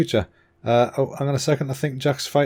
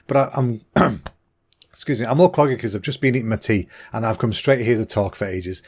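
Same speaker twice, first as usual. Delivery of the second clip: ordinary talk, nothing marked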